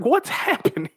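high, laughing bewilderment; laughing disbelief. Retold or imitated. Hearing the surprise news backstage, laughing bewilderment. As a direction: laugh through the question, floored.

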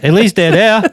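put-on high, loud Boston accent, Rs dropped. Guest repeating his uncle's exact quote, Boston accent. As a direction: hammer out a thick imitated Boston accent. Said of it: "example of a Boston accent".

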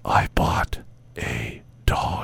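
very slow, breath-only whisper; mock seductive whisper. Host teasing co-host with her dream line, seductive mock whisper. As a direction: whisper it slowly into an ear. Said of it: "whisper into my ear".

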